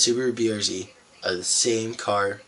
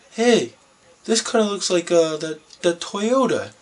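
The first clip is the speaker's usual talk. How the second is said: high, loud mock voice of a clueless car show visitor; clueless guy; 9 semitones higher, 5 dB louder than usual. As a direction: play the dopey bystander, pitched way up.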